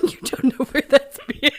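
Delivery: warning through giggles; giggly warning; laughing. Real, not acted.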